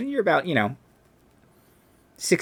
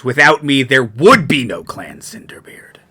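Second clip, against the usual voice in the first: proud declaration; 9 dB louder than usual. Acted. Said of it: "fires in his beard crackle brighter".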